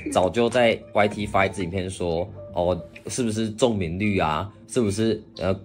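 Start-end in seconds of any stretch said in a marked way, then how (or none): none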